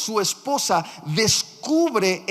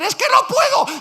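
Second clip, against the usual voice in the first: high, loud, fast frantic cry; panicked shout; 6 dB louder than usual. Retold or imitated. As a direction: shout like a man about to lose everything. El hombre desesperado. (grito desesperado, agudo, fuerte y rápido)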